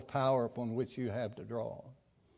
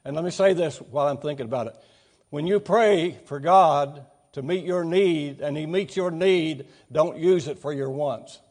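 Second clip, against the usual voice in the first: preaching at full force; 3 semitones higher, 8 dB louder than usual. Acted. Preacher pressing a point.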